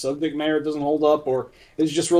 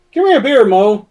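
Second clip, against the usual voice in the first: loud, higher cartoon bar order; mock barroom demand, higher and louder; imitating Simpsons character; 5 semitones higher, 7 dB louder than usual. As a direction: bellow for a beer like a cartoon drunk. Quoting a Simpsons drunk barfly.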